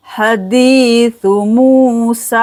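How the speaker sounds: हदीसु मूसा slow, with long dee, moo and saa; slow chanted हदीसु मूसा with drawn-out vowels; stretched long vowels. Shown as a demonstration. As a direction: draw out dee in हदीसु, keep ha and su quick. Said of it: "दाल को खींचेंगे दीसु".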